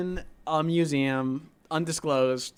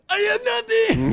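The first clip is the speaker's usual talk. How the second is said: very high, loud mock cry; imitated video outburst; 6 dB louder than usual. Imitating a guy from a video, high outburst. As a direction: squeal it like an exaggerated viral-video impression. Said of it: "It's some guy trying to pay".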